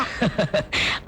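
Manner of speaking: soft breathy chuckle; amused laugh. Acted. Amused by little sister's report. (மெல்லிய சிரிப்பு)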